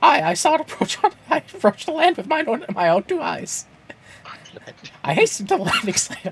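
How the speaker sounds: high squeaky falsetto reading; cartoon mouse telling a solemn report; Mickey Mouse. Guard reports the airship sighting, Mickey Mouse impression. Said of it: "interrupt your Mickey Mouse reading".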